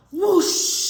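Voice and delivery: loud, high gusting whoosh; wind whoosh. Retold or imitated. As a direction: imitate a sudden gust of wind.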